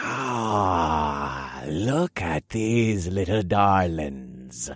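slow, silky purring voice. A narrator's reading. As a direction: purr it like a sly monster. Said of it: "purred an angel-faced man".

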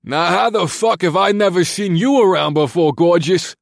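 put-on loud, high, slurring frat boy; loud drunk voice. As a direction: yell it like a drunk jock. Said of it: "interrupted by a loud and drunken voice".

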